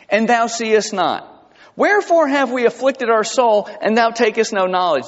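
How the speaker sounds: high-pitched aggrieved scripture quote; complaining teenager. Acted. Preacher quotes the fasting people's grievance to God, teenage complaint. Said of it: "That sounds like a teenager".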